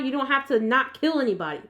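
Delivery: usual speaking voice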